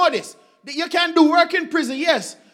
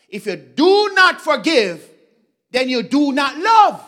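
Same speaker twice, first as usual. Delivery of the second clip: preaching emphasis; 7 dB louder than usual. Real, not acted. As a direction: hammer each word home. Preacher driving home point.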